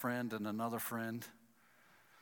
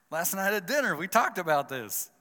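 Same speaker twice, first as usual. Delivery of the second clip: louder, exasperated complaint at friends; frustrated; 4 semitones higher, 7 dB louder than usual. Retold or imitated. Hunter complaining about friends who left, exasperated and louder. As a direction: vent it, louder, hurt and annoyed. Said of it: "at first I'm frustrated".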